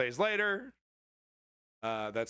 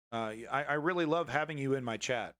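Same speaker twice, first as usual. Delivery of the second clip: usual speaking voice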